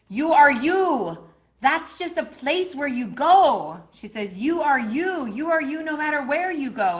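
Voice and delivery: put-on high, loud, slow Argentinian-accent insistence; Argentinian-accented healer. Argentinian healer firmly reassuring her upset client. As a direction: drill the point in, Argentinian healer style. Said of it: "really strong ... kind of accent".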